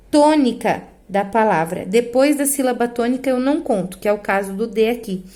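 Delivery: normal talking voice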